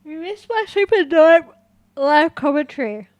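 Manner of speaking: mock hype. Mock boasting to chat.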